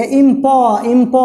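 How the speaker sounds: इम प in two parts, "im-paw"; syllable-by-syllable demo. Shown as a demonstration. Teacher counting vowel sounds, इम प sounded out. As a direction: sound out इम प with the last vowel held. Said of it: "प बोल दिया".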